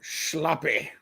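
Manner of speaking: a hint of gruff old coach; Lou Holtz impression. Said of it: "hit us with another Lou Holtz".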